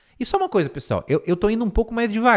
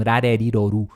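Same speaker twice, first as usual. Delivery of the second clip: flapped R syllables; 5 semitones lower than usual. Shown as a demonstration. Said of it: "o T tem meio que um som de R".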